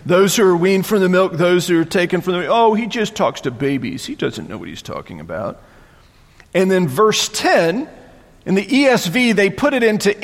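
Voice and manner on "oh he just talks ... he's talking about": scornful brush-off; mocking tone. Mockers dismissing Isaiah, scornful tone. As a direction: sneer it, then let it drop away. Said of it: "that tone of voice".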